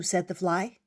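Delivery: usual speaking voice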